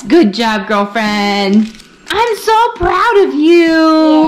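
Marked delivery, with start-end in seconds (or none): 2.1-4.3 potty training praise. Real, not acted. Praising toddler.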